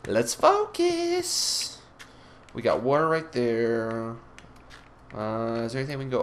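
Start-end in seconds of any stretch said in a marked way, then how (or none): 0.1-1.8 mock sing-song. Repeats own line, stretched high.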